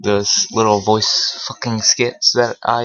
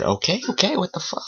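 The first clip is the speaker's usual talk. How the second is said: startled okay; 8 semitones higher, 5 dB quieter than usual. Retold or imitated. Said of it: "I said".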